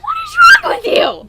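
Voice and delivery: very high, loud outburst; outraged high-pitched demand; exasperated shriek. Real, not acted. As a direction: screech it in disbelief. Outraged at partner's opinion.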